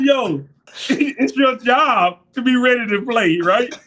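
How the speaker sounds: laughing scold. Retold or imitated. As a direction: scold him while cracking up. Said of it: "I said".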